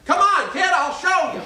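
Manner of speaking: booming [name]. Retold or imitated. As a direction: belt it to the second balcony. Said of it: "in that booming voice".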